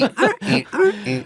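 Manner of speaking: high rhythmic "eh" squeaks; short, high, repeated mock grunts; mock sex noises. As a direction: imitate a couple going at it in the background. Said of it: "when they're going".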